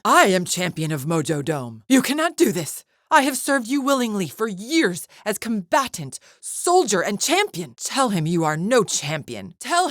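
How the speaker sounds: high, indignant plea of a wronged champion; outraged protest. Acted. Champion objects after years of willing service, outraged. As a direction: protest hotly, pitch leaping up.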